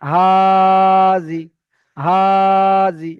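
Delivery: হাজি with হা held long and loud, জি short; long drawn vowel. Shown as a demonstration. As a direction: stretch হা in হাজি, clip জি. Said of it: "এমনভাবে আমাকে practice করতে হবে".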